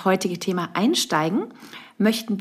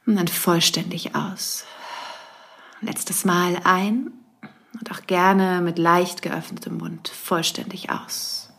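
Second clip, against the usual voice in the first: guided meditation. Acted. Leads a breathing meditation.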